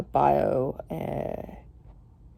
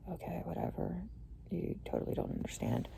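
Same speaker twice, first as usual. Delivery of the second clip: breathy, barely voiced brush-off; dismissive whisper; 3 semitones higher, 15 dB quieter than usual. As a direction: mutter it off like you don't care. Mocking her own dismissive reply.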